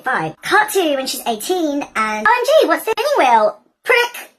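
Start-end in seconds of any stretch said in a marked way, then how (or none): 2.3-4.3 put-on very high, loud teen squeal; excited squeal. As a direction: shriek it out like a giddy teenager. Aurora voiced, squealing.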